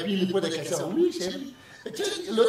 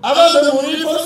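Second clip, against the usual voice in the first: loud, raised demand; shouting; 6 semitones higher, 8 dB louder than usual. Acted. Wife loudly demanding the house before dying. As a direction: belt out the demand.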